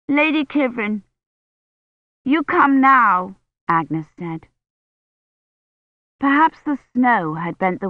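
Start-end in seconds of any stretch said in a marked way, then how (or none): 0.1-3.3 put-on higher, slow little girl's voice; a small child pleading slowly to the lady; small girl calling. Little Agnes calls to Kivrin.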